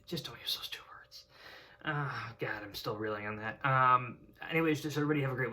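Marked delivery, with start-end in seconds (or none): 0.0-1.2 hushed, breathy under the breath; a whispered aside to viewers; whispered warning. Real, not acted. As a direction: drop to a whisper, dead serious.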